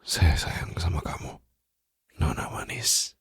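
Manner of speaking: soft loving whisper; tender whisper. Acted. Tender confession to captive. (bisikan penuh sayang)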